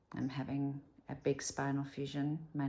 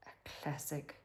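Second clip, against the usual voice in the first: very soft whispered words; hushed confiding whisper; 9 dB quieter than usual. Real, not acted. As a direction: whisper it like a secret. Whispered before naming narcissist.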